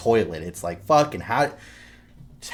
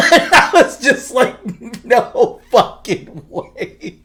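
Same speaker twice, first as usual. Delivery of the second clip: high, loud words through real laughter; helpless laughing, drawing the words out; cracking up; 13 dB louder, much slower than usual. Real, not acted. Dad retelling his daughter's duck tantrum, cracking up. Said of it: "cracks me up".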